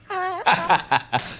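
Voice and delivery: high-pitched giggly laugh; high squealy laugh. Retold or imitated. Mocking the One Show co-host.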